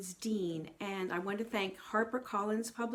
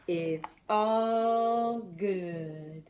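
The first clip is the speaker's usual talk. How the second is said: slow cool drawl; 3 semitones lower, 3 dB louder, much slower than usual. Retold or imitated. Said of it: "as Pete says".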